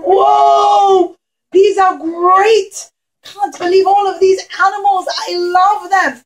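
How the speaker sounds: high, loud whoa of delight; excited [name]. Acted. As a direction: cheer like a kid seeing gifts. [name] thrilled by animals.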